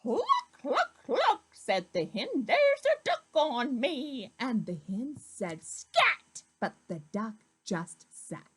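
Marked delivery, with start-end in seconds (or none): 0.0-1.5 very high, louder clucks; hen clucking. Acted. Hen speaks in story. 2.5-4.3 high, louder hen voice; a fussy hen grumbling. Acted. Hen complains of duck. 5.6-6.4 high, louder "Scat"; hen shooing. Acted. Hen shoos the duck.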